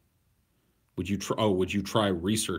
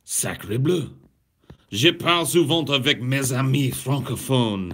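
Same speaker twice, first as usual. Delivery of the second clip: put-on loud, higher showy French accent; proud put-on French; 5 semitones higher, 7 dB louder than usual. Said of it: "How dare you impugn my French".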